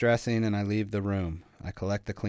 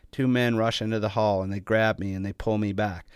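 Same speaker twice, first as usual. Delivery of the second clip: usual speaking voice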